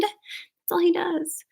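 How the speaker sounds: slow, hushed aside; deadpan whisper. A narrator's reading. Narrator whispers the dull verdict to listeners.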